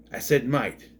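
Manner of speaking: usual speaking voice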